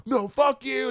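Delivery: high mock holler; yelled comeback. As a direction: shout back from a moving car. Buddy yells back from car.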